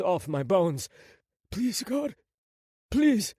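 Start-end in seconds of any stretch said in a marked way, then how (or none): 1.5-3.3 high-pitched desperate plea; begging. A narrator's reading. Prince begging God as he burns, pleading. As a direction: beg through the pain. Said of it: "I begged".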